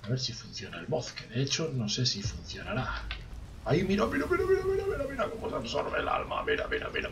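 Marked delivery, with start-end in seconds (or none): 3.6-6.4 tiny high-pitched squeak, fast at the end; squeaky high voice. Pretend. Silly voice while absorbing soul. 6.4-7.1 sudden low growly register; deep voice. Pretend. Voice drops suddenly.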